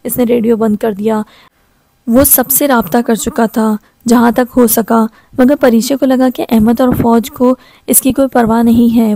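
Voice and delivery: ordinary delivery, nothing marked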